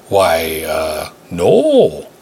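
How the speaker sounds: "no" held long with a rounded Minnesota O. A narrator's reading. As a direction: stretch "no" into a round drawn-out O. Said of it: "held the well-rounded O and no".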